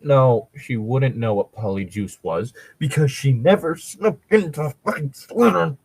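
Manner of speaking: ordinary talk, nothing marked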